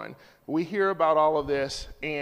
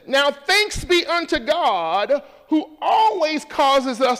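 preaching at full force; 6 semitones higher, 6 dB louder than usual. Real, not acted. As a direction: proclaim the verse to the room. Preacher reads scripture loudly.

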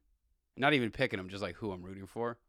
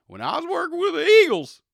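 much higher, shouted sports-radio bluster; amped-up radio guy; 17 dB louder than usual. Retold or imitated. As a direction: pump yourself up like a hot-take host. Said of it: "a taste of how he sounds".

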